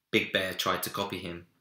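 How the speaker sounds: usual speaking voice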